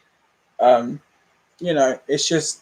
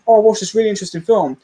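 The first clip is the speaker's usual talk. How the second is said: eager; 6 semitones higher, much faster than usual. Retold or imitated. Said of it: "and I'm like".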